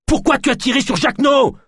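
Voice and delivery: very high, outraged shout; enraged, screamed demand; furious roar. A narrator's reading. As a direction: hurl the accusation at full voice. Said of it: "rugit Naya".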